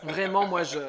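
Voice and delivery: normal talking voice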